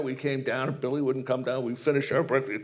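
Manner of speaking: usual speaking voice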